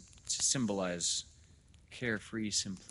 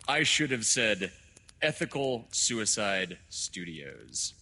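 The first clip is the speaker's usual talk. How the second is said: loud start, slowing on the title; grand correction; 3 semitones higher, 8 dB louder, slower than usual. Acted. Doctor correcting himself, loud and deliberate. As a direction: declaim the official title slowly, with weight.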